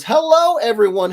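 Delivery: high, loud cheery hello; reading out a chat greeting. Retold or imitated. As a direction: sing out the viewer's hello. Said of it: "Chad Park jumps in and says".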